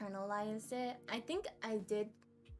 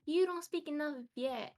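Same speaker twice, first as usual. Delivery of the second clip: mild mock yell, higher pitched; retold parental scolding; 6 semitones higher, 4 dB louder than usual. Daughter imitating parents complaining about her Vietnamese, mock scolding. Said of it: "my parents yell at me".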